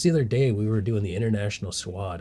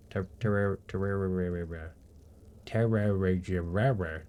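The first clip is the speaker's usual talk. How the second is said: garbled tongue-tie; 3 dB quieter, much slower than usual. Pretend. Garbles the game's name on purpose.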